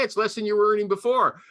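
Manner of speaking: usual speaking voice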